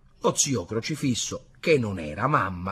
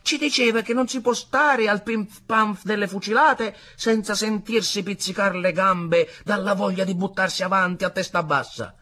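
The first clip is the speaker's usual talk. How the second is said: higher-pitched, eager war tale; animated soldier storyteller; 9 semitones higher, 5 dB louder than usual. A narrator's reading. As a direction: relive the battle for the listeners. Soldier character tells his battle tale.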